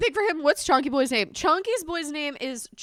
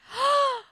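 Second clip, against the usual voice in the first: excited gasp; 11 semitones higher, 3 dB louder than usual. Real, not acted.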